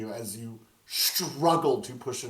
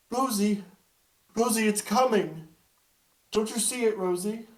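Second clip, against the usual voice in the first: put-on high, louder child calling in alarm; a boy warning his friend something comes; frightened child; 10 semitones higher, 5 dB louder than usual. GM plays Thorn, scared child.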